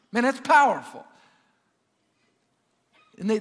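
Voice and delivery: loud, emphatic sermon delivery; forceful preaching. Acted. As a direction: preach it out to the whole church, punching the key words.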